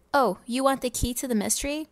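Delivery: usual speaking voice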